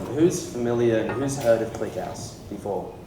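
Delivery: ordinary talk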